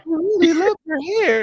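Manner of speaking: high, gushing voice; dreamy compliment. Retold or imitated. Sisters in a dream sequence gushing, high-pitched. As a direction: gush over her hair in a high voice. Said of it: "they're just like".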